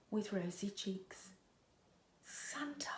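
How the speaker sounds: awed whisper. A narrator's reading. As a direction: reveal him in an awed hush. Santa revealed to James.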